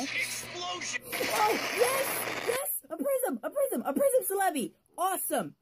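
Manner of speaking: high, loud, thrilled yelling; excited shouting. Real, not acted. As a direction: shout it out in pure joy. Pulls his first Prism card.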